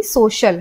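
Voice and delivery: सोशल said with a clear sh sound in the middle. Shown as a demonstration. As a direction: give सोशल a sh, not s or k. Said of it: "इसे हम श की ध्वनि देंगे". (सोशल में श की ध्वनि)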